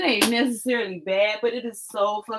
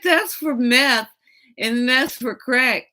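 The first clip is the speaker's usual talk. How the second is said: slow, spelled-out, a bit louder; mother spelling it out to daughter, slow and firm; slow emphatic explanation; 3 semitones higher, 4 dB louder, slower than usual. Retold or imitated. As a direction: lay it out word by word for her.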